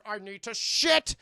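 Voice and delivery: loud, slow comic outburst; hammed-up desperate announcement; mock urgency. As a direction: blurt it out with a heavy last word. Jokey line on being bloated.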